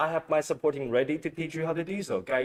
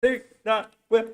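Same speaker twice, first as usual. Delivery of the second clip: excited cheer; 8 semitones higher, 4 dB louder than usual. Real, not acted. Co-host joins the wheel spin.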